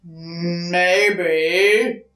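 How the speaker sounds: growling bear. Acted. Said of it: "growled the bear".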